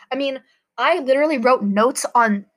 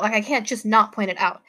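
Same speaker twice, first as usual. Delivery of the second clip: normal talking voice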